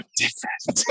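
whispered breathy voice. Pretend. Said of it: "whispery, breathy voice".

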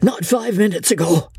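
high, strained cries broken by coughs; urgent spluttering. A narrator's reading. Chief directing his secretary to the door, spluttering urgently. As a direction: choke out the words urgently, high-pitched.

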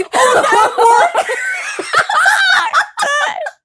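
long high shrieking laugh; howling with laughter; loud laugh. Real, not acted. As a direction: crack up loudly. Laughing at dog joke.

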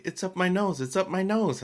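put-on a light take on a crying child; imitating crying daughter. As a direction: voice the upset toddler. Crying toddler voiced by dad.

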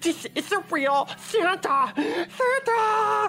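put-on high, squealing child's voice; excited little kid. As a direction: shriek like a thrilled small child seeing Santa. Retells a child seeing Santa.

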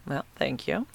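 normal talking voice

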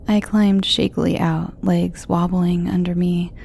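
plain reading voice

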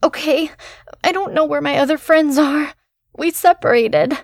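put-on high, anxious whispering girl; scared and breathless, keep it tight; frightened young woman. Frightened girl lost in the dark.

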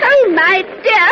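high, loud, drawn-out dismay; distressed wife. Acted. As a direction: fret aloud over a stricken husband. Wife distressed over sick husband.

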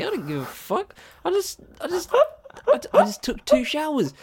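real heated outburst, voice jumping up high; defending himself hard after being called out; passionate. Real, not acted. To his co-host, a heated high-pitched defence. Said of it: "I haven't heard you this passionate in months".

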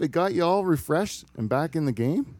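high, loud, teasing questions; mock excited teasing. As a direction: rib him with fake delight. Teasing the producer.

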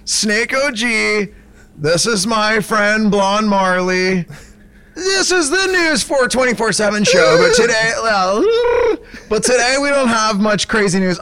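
high, laid-back stoner host; host a goofy stoner show; stoner bro host voice. Acted. Snake O G hosts fake show.

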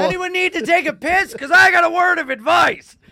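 loud, very high hammy declaration; over-the-top actor. Pretend. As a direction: belt it up high like a ham.

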